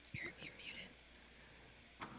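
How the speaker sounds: slow real whisper; telling a player he is muted, whispered slowly. Real, not acted. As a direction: quietly whisper the aside. Telling a player off-mic he's muted.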